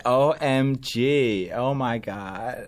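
very slow, stretched valley drawl; mock the host's drawn-out voice; Kardashian-style drawl. Guest mocking the host's Kourtney Kardashian drawl, slow and stretched. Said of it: "I just did an impression of you".

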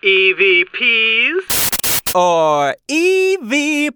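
big, slow, drawn-out announcer call; game show announcer. Acted. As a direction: announce the game title like a host.

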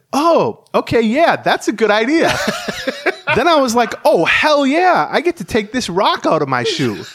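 high, loud, mock-giddy pitcher; excited quote. Reporter telling a co-host about the pitcher, in a high, mock-giddy voice. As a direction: play up the pitcher's joy at getting the rock out. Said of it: "this is Gray talking".

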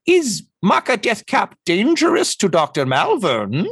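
high bird-creature voice rising in worry; genuinely concerned. Acted. Bird doctor asks Tortle about danger, concerned high voice. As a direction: ask warily, pitched high, as a bird doctor. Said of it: "a generally concerned way".

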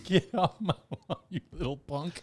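laughing mock get-off-my-lawn yell; grumpy old man yelling. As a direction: play a cranky old neighbor chasing kids off.

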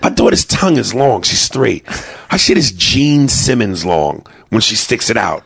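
ordinary talk, nothing marked

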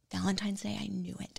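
hushed, secretive whisper; knowing whisper. Retold or imitated. Recalling her realization to the host, whispered. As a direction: breathe it like a secret you just cracked.

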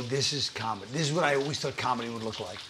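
hushed awe at first comedy show; awed hush. Retold or imitated. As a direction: murmur it in wonder. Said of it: "I go".

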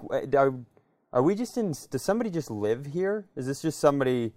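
ordinary talk, nothing marked

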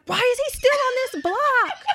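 very high, loud mock panic; hammed-up, high-pitched alarm; imitating panicked friend. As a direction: mimic a freaked-out friend way up high.

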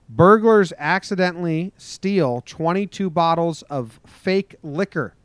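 loud, emphatic newscaster delivery; news headline read. Acted. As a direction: announce it like a newscaster.

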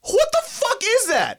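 high, stunned disbelief; shocked. Retold or imitated. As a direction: squeak it out in shock. Shocked reaction to nerd voice.

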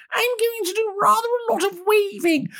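very high, far-off prim royal voice; mock Queen answer. The Queen answering an imagined job interview, very high and prim. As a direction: squeak it out as a prim old queen. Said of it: "She didn't go for an interview where they said".

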